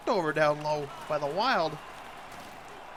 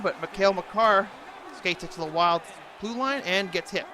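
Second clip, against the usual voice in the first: ordinary talk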